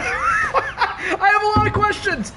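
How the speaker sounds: laughing through the words, high and slow. Real, not acted. Streamer baffled by the ring, laughing hard. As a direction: stretch it out while cracking up.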